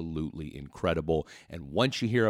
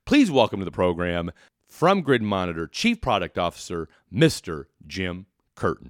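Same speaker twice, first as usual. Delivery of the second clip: announcer intro; 5 dB louder than usual. Acted. Host formally introduces guest on program.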